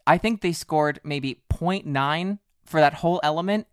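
ordinary talk, nothing marked

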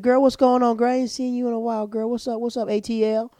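high, put-on girlfriend chatter; mock gushing greeting. Greeting an acquaintance in the club section, mock gushing. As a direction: gush hello high, running on without a break.

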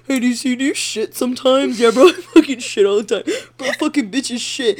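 laughing, put-on voices of haters; mocking impression. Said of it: "somebody would just mock us by being like".

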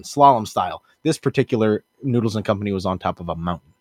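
usual speaking voice

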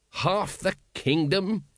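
usual speaking voice

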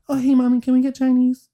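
put-on high, loud innocent child voice; innocent kid. Son turning innocent for his mom, high childish voice. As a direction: play the sweet little boy. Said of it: "He goes".

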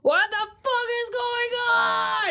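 high, drawn-out outraged shout; pissed. Retold or imitated. Kuro arriving to find his crew failing, pissed-off shout. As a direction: yell it in hammed-up fury. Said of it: "he's, like, pissed".

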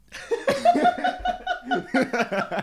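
very high, loud burst of laughter; shrieking laugh. Real, not acted. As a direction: crack up hard. Huge laugh at the line.

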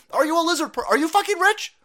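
high, loud demanding questions; angry challenge. Real, not acted. Host challenges the audience, angry demanding. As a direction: fire the questions at the listener.

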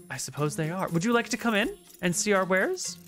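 high, sweet, shy little gnome voice; gentle gnome shopkeeper. Acted. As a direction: play a tiny gentle gnome, delighted. GM voices Valence.

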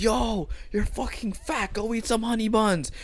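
high, drawn-out jeering call; mocking taunt. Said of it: "we would make fun of him".

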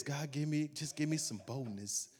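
soft, meek mock plea, sinking away; mock timid prayer. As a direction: beg for boldness without any. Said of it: "and you pray like this".

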